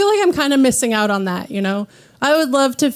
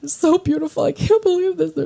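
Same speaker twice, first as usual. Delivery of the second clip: imitating a weepy groom; 6 semitones higher, 4 dB quieter than usual.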